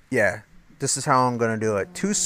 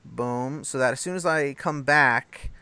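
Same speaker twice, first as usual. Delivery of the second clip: ordinary delivery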